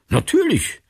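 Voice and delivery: a sudden high, surprised yes. A narrator's reading. Martin, surprised by his colleague's request, answers surprised. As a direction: caught off guard, agreeing at once. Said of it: "sagte Martin überrascht".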